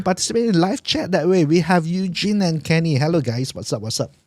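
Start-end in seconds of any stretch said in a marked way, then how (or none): none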